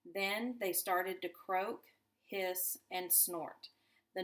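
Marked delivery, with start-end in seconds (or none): none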